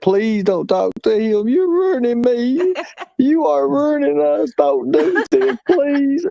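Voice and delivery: high, slow whiny begging; imitating a crying man begging her; mock pleading Benny. Mocking Benny begging Candace not to talk to him, high whiny plea. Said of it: "He said".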